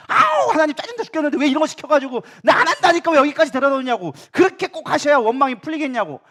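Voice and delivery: high, loud exasperated complaint; angry outburst. Acted. Jonah venting his annoyance at God, angry and high-pitched. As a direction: lash out in whining, fed-up protest. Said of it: "이러면서 화를 내면서".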